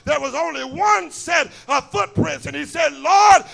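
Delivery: rising, shouting preacher cadence; preaching at full force. Acted. Preacher pressing the congregation, full-force shout. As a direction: drive the question home to the church.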